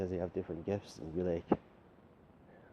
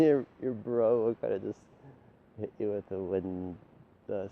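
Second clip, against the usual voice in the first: ordinary delivery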